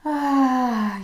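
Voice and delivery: loud open sigh on "Ah"; voiced sigh. Shown as a demonstration. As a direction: sigh the breath out with the voice. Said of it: "sigh out a few breaths here".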